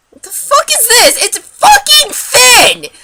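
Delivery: high, loud exasperated outburst; outraged rant. Real, not acted. Ranting to viewers about ruined eyebrows, outraged. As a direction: shout it in disbelief at the botched job.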